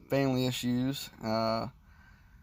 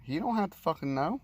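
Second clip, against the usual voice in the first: mock defiance; 6 semitones higher than usual. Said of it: "I'm like".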